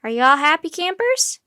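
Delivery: rally the passengers; cheery call. A narrator's reading. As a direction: guide cheerily calling to the group. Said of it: "Nancy called over her shoulder".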